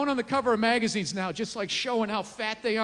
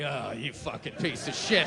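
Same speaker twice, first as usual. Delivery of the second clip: much lower, disgusted voice; self-disgusted mutter; 12 semitones lower, 4 dB quieter than usual. Retold or imitated. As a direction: sneer at yourself in the mirror. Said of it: "you're like".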